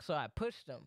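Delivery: ordinary delivery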